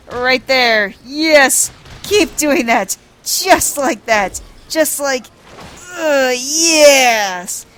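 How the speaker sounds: loud, hammed-up blissful moaning nobleman; ecstatic noble. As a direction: groan with overblown relief, slowing down at the end. Hildebrand demanding more massage.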